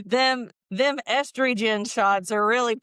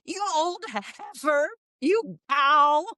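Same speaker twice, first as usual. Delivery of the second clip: high, slow crotchety granny; a cranky old woman insulting; old lady voice; 6 semitones higher, slower than usual. Shown as a demonstration. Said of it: "the old ladies would not say bitch".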